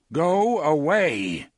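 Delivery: slow, loud, far-off command; booming cry. A narrator's reading. The wizard orders them away, booming. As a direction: bellow it from the pipes. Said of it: "cried the voice from the pipes".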